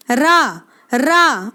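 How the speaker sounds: रा said short and clear, with a rolled tap on r; sound demo. Shown as a demonstration. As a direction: model रा for the students. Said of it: "आप रा को बोल के देखें".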